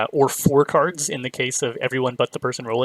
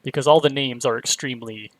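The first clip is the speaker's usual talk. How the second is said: usual speaking voice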